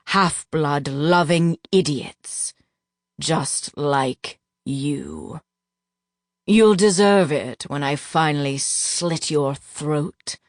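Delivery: slow, low menacing voice; menacing threat. A narrator's reading. Duke threatening the captive narrator, slow low menace. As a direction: drag out each word with cold menace.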